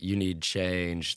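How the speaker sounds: slow mushroom voice. Retold or imitated. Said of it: "the mushrooms were like".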